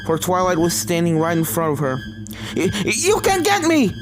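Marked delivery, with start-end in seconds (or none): 2.5-3.9 spat. Acted. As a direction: spit the words at the ghost. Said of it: "Starlight spat".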